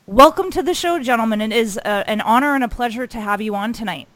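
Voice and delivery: big announcer-style welcome; loud host welcome. Real, not acted. Host welcoming the guests on air, loud and formal. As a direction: boom it out like a show host.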